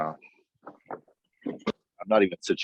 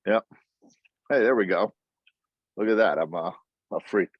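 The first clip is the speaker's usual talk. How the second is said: ordinary talk, nothing marked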